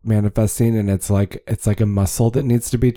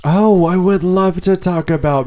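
excited; 9 semitones higher, slower than usual. Real, not acted. Eager about favorite topic.

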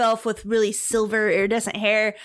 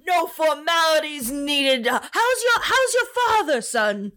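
put-on higher, jovial old officer; hearty commander; 5 semitones higher than usual. Commander asks after a father, jovial. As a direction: put him at ease.

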